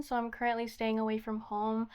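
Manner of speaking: ordinary talk, nothing marked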